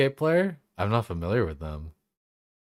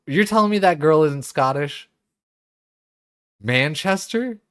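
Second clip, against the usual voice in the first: incredulous; 7 semitones higher, 5 dB louder than usual. Real, not acted. Shocked the girl isn't Scottish.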